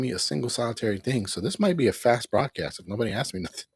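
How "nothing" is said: ordinary delivery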